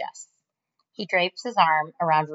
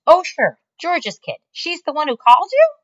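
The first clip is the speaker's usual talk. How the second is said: higher, loud, surprised boss on the phone; 11 semitones higher, 8 dB louder than usual. Acted. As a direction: react in disbelief to the caller. Mac voiced on phone.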